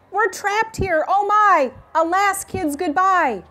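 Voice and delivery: high, loud, frantic wail; panicked scream. Acted. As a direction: shriek it like a teacher who thinks all is lost. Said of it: "started to scream".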